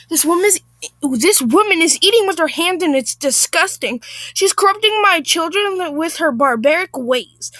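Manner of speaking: affronted, emphatic whining; disgusted Karen. Acted. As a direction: tattle to the manager in outrage. Acted Karen complaining to manager.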